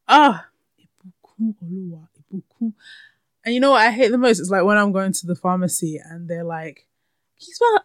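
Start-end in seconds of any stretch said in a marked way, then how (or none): 0.8-2.7 "Ibukun Oluwa" soft and tuneful, relished; savoured name music. Shown as a demonstration. Host loving her beautiful name, "Ibukun Oluwa" savoured musically. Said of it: "I have to put the music". 7.4-7.8 high, louder mocking squeak; mock the clerk in a high-pitched voice; mimicked pharmacy clerk. Pharmacy worker asking her to spell it, mimicked high.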